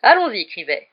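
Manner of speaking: loud, jovial go-ahead; rally him with a big grin; broad smile. A narrator's reading. Teacher urging the young photographer, smiling broadly. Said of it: "dit Lockhart avec un grand sourire".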